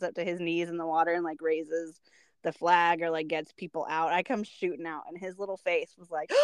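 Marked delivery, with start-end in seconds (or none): none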